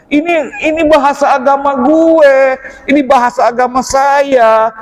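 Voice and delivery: high-pitched, acted-out lament; dismayed self-reproach. Student scolding himself over his religion's language, dismayed lament. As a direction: protest at yourself, voice lifted high. Acts out dismay at own ignorance.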